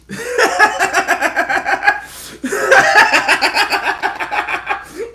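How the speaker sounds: very high, long burst of laughter. Real, not acted. Streamer laughing before telling the gas station story, high cackle. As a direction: lose it completely laughing. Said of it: "a little laughter".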